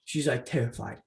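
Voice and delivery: normal talking voice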